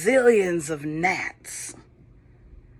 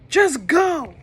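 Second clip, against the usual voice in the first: loud, higher shout to send them away; angry shout; 10 semitones higher, 7 dB louder than usual. Acted. Pharaoh sending the people away, loud shout. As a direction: yell it as the beaten king. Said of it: "Pharaoh shouted".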